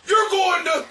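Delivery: high, loud cut-off condemnation; mock preacher shout. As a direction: start damning them like a preacher.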